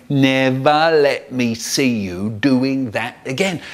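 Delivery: slow, measured mock scolding; funny dad voice. Brother in bed mimicking Dad, mock stern. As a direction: imitate a telling-off dad word by word. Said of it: "just like my dad, going".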